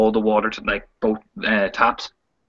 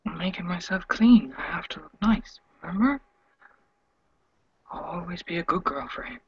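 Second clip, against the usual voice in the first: breathy whisper, higher, detached; eerie whisper; 6 semitones higher, 6 dB quieter than usual. Acted. Sasha whispering to Alex while scrubbing raw, eerie. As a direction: whisper it like a broken doll.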